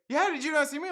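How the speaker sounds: high, accusing retort; put-on outraged questioning; mock indignant. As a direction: imitate an offended stranger pressing the point. Acts out the lady's challenge.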